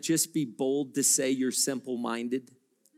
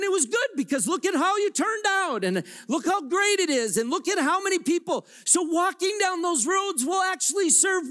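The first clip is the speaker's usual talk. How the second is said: full-force preaching; 12 semitones higher than usual. Real, not acted. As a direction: preach it out over the whole room.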